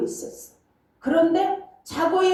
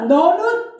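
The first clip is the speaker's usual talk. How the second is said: loud, high, commanding call; booming voice of God; 6 semitones higher, 7 dB louder, slower than usual. Retold or imitated. God calling to the pastor praying alone, booming. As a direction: boom it out like a voice from heaven. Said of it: "이렇게 하는 것처럼 들리는 거예요".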